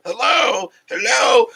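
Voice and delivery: high, loud hammed-up hello; mock calling out. Joking he's sunk in the couch, calling out high and loud. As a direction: call out as if lost in the couch.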